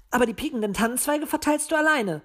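high-pitched, demanding little owl; high squabbling owl; bickering owl. A narrator's reading. Owl Paul bickers with Willi.

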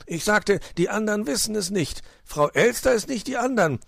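high-pitched, exasperated explaining; flustered fox making excuses; defensive fox uncle. Acted. Fox talks his way out.

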